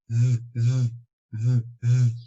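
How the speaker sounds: "z" held and vibrating like a ringing phone; buzzing z. Shown as a demonstration. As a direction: buzz the "z" out loud. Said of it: "Esse é o som do telefone tocando". ("z" vibrado como telefone tocando)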